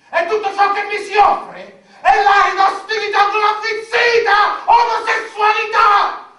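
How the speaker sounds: high, desperate wailing shout; anguished shout. Acted. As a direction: cry it out in despair. Anguished outcry about his life.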